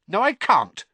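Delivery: high, loud, snappy refusal; snapping. Acted. Hermione refusing Ron's invitation, snapping. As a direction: shut him down sharply. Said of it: "snapped Hermione".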